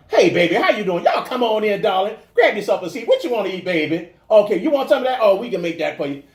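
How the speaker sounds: high, loud, affectionate Southern greeting with a laugh; Southern restaurant welcome. Retold or imitated. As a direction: greet diners like a Southern waitress calling everyone baby. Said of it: "And down South, you walk to a restaurant".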